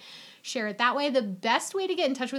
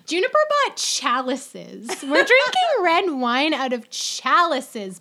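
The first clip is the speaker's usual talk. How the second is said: excited; 5 semitones higher, 10 dB louder, slower than usual. Real, not acted. Excited about wine chalices.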